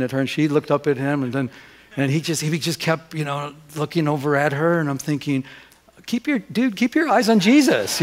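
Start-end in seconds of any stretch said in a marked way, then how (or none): 6.1-8.0 higher, louder comic rebuke; mock indignant thought. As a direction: tell him off, mock-outraged. Jealous inner retort to worship leader.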